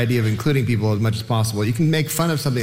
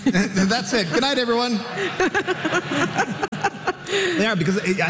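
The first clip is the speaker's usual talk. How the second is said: high, laughing mock sign-off; 12 semitones higher than usual. Real, not acted. Comedian jokingly ending the panel on a sad note, laughing. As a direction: wrap up the show while cracking up.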